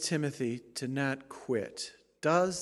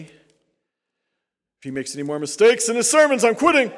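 louder, higher mock grumble from a congregant; mock complainer; 7 semitones higher, 14 dB louder than usual. Pastor mocking a congregant who counts his mistakes, louder. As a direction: ham up a fed-up churchgoer.

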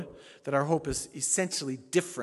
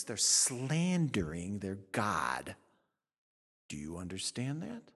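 ordinary talk

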